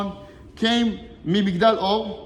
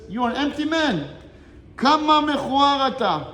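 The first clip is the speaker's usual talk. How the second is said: higher, louder, contemptuous retort; scornful rabbi; 6 semitones higher, 4 dB louder than usual. Retold or imitated. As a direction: sneer it down at him, slowing on the last words. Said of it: "So the rabbi is speaking to him like this".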